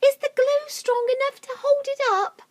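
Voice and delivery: very high anxious question; worried animals. Acted. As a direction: ask nervously.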